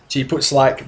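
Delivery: ordinary talk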